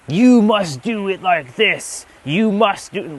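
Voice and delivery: mock chastising dictator. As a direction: play the scolding dictator he isn't. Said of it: "not to be chastising".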